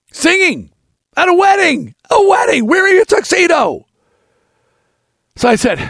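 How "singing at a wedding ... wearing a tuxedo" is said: hammed-up disbelief at the gig; mock outraged rant. Complaining to the audience in a mock outraged shout.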